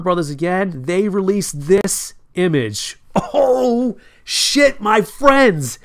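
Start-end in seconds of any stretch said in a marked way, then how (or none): 3.1-5.8 high excited exclamation. Real, not acted. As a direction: blurt it out in excitement. Reacting to new image.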